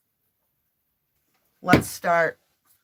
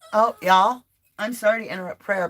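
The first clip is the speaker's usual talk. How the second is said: ordinary talk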